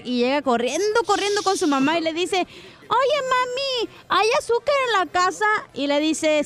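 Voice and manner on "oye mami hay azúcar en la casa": lightly voiced little boy, higher; a hint of an eager child asking mom; boy pestering his mom for sugar, slightly higher; kid voice. Piolín as a kid asks mom.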